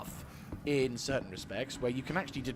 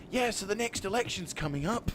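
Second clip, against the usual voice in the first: acted future self; 8 semitones higher than usual. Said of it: "I'm going to be like".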